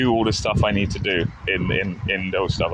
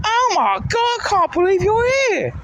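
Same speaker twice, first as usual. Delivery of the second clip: very high, thrilled imitation of a friend; excited squeal; 4 dB louder than usual. Friend amazed to bump into them, squealing with excitement. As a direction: squeal it like you can't believe your luck. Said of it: "She went".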